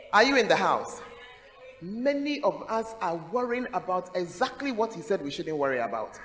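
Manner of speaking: usual speaking voice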